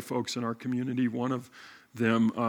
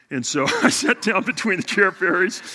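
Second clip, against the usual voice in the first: laughing; 7 semitones higher, 8 dB louder than usual. Real, not acted. Laughing at the Chair Fairies.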